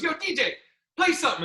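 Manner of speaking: high-pitched retold call to a DJ; urgent demand. Acted plea to the DJ.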